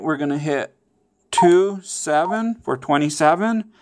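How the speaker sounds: ordinary talk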